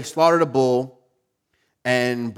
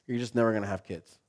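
offhand doctor; 2 semitones lower, 7 dB quieter, faster than usual. Retold or imitated. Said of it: "he's like".

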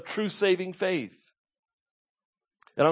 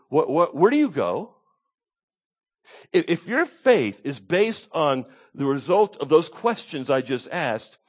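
usual speaking voice

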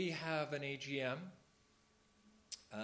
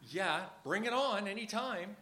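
sarcastic eager yes, pitched higher and louder; mock enthusiasm; 7 semitones higher, 7 dB louder than usual. Speaker mocking well-meant scripture quotes, sarcastic cheer. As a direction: pretend to welcome the advice, tongue in cheek. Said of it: "and you go".

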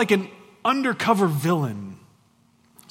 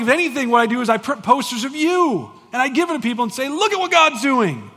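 Paul voiced; 7 semitones higher, 6 dB louder than usual. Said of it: "Paul's like".

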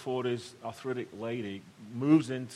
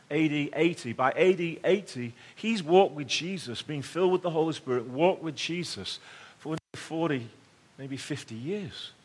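normal talking voice

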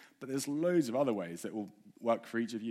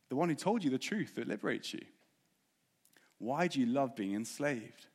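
normal talking voice

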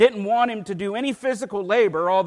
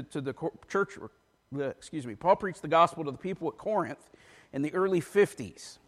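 ordinary talk, nothing marked

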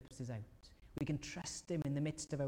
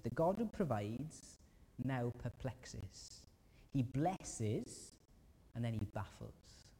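slow emphasis; slower than usual. Real, not acted. Slowed emphatic contrast.

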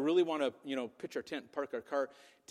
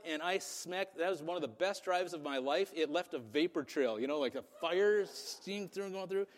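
ordinary talk

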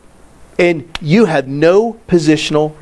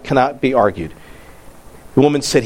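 ordinary talk, nothing marked

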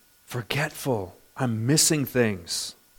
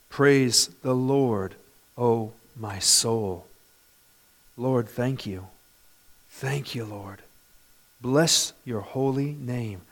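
slow, soft, low prayer of thanks; hushed prayer; 4 semitones lower, 5 dB quieter, slower than usual. Real, not acted. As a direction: let the praise sink into quiet personal thanks. Turns psalm into prayer.

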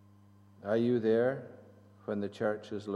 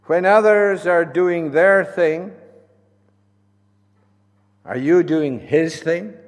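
preaching; 7 semitones higher, 15 dB louder than usual. Acted. Preaching at full force.

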